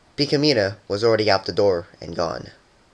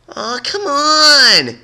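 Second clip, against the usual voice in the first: high, very slow drawn-out whine; whiny plea; 7 dB louder, much slower than usual. A narrator's reading. Pleading after failed tries.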